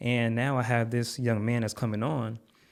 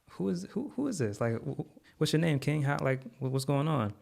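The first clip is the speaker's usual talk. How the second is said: surprised confusion; 5 semitones higher than usual. Retold or imitated. Stunned caller thanks him live.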